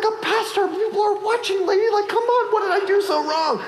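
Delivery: high, fast flustered pleading; panicked plea. Retold or imitated. Pastor pleading with the angry woman while people watch, high and flustered. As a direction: beg her to stop, embarrassed. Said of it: "I'm like".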